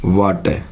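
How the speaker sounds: वाटर ending in an open "eh", no R; silent final R. Shown as a demonstration. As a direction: drop the R at the end of वाटर, saying "waa-te". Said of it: "आर का pronounce नहीं होगा".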